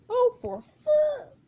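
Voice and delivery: high, loud, drawn-out curse; slow, high-pitched cry of frustration; exasperated outburst. Acted. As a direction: blow up in acted exasperation. Character's exasperated outburst at director.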